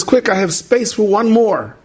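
high, drawn-out urgent call; mock calling out without much volume; retold yell. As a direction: beckon someone aboard, acted out. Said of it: "the guy yells out".

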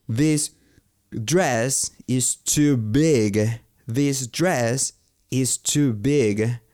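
drawn-out, careful model sentence; very slow teaching demo. Shown as a demonstration. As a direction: stretch every word for learners. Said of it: "teacher models English phrases".

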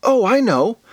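high-pitched excited realization; light up with an idea; sudden bright idea. Acted. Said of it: "Then her face lit up".

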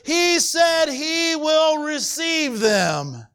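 high-pitched, sustained preacher's cry; impassioned preaching. Real, not acted. Preacher telling the congregation who Jesus receives, impassioned preaching. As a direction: drive each phrase up high, stretching the promise at the end.

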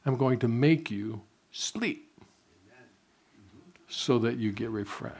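slow, gentle, as God speaking; God's voice. Retold or imitated. Preacher voicing God promising sleep, slow and soft. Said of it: "God goes".

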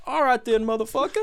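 high, cocky battle taunt; badass comeback. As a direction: toss off a cocky fighting line. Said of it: "it was the most badass, just like".

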